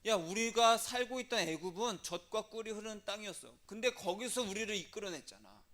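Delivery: indignant complaint. Retold or imitated. Rebels recalling Egypt to Moses, indignant complaint. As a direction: protest as if cheated.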